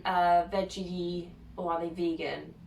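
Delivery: ordinary talk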